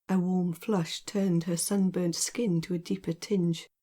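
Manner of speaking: ordinary delivery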